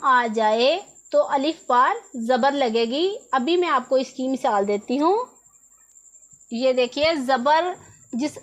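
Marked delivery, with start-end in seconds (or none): none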